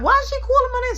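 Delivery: high, loud panicked question; mock panic. Kid panicking at mom's call, high and loud. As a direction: sound caught out.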